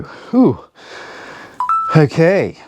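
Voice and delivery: panting after the exercise set; out of breath between words; heavy breathing. Real, not acted. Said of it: "I apologise about any heavy breathing".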